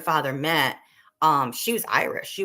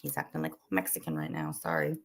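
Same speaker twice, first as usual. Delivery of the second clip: lower, softer deadpan joke; joking aside; 11 semitones lower, 9 dB quieter than usual. Retold or imitated. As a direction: toss off the joke under your breath. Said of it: "I would joke and I'd say".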